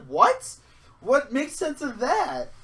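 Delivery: high, loud disbelief; high-pitched comic bafflement; retold incredulous outburst. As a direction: exclaim it in baffled indignation. Retold disbelief at robot hanging up.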